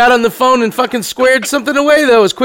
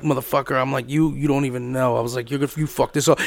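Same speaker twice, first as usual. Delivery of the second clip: much lower and softer, threatening; low quiet menace; 10 semitones lower, 10 dB quieter than usual. Retold or imitated. Miguel on the balcony threatening his friend, low quiet menace.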